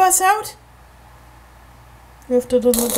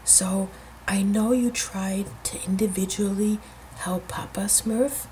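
whispering; 8 semitones lower, 13 dB quieter than usual. Real, not acted. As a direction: whisper the plan to the toys. Whispering plan to Smurfs.

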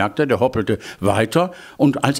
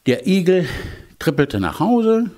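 ordinary delivery

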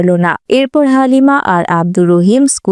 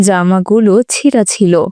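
ordinary delivery

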